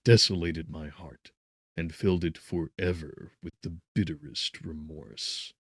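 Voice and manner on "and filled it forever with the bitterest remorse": plain reading voice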